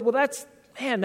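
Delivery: usual speaking voice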